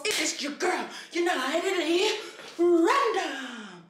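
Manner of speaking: big drawn-out announcer intro, pitched high; hyped intro. Pretend. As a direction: stretch the name out like a hype announcer. Hyped channel intro shout-out.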